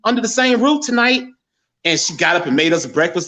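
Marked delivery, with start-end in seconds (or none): 0.0-1.2 raised-pitch, fond mother voice; imitating his mother. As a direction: lift the voice into mom's happy tone. Retelling mother's words.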